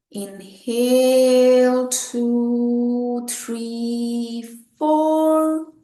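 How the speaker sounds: long drawn-out inhale count, rising at the end; sustained, lifted counting; breath count. Shown as a demonstration. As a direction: stretch each number to pace a slow breath in.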